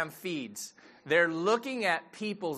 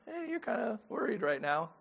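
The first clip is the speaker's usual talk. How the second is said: a hint of a gentle dog, softer; soft imagined dog; 5 semitones higher, 4 dB quieter than usual. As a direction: nudge quietly like a caring pet. Dog voiced gently nudging owner.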